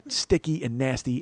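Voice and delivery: ordinary talk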